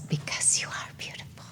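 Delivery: whispered very softly; tender whisper. Retold or imitated. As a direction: hush it like a secret compliment. Tender quote in memorial.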